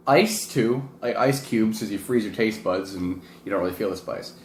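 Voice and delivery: ordinary delivery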